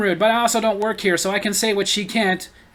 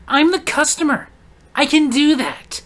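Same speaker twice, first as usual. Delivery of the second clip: higher, louder, entitled mock; haughty customer; 6 semitones higher, 4 dB louder than usual. Rude shopper insists on her rights to the cashier, entitled mock. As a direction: toss it off like a spoiled customer.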